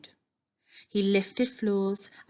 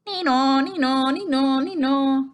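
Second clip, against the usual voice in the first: loud, high siren hoot; nee-naw siren waking the house; hooter; 5 semitones higher, 6 dB louder than usual. A narrator's reading. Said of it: "a hooter would hoot".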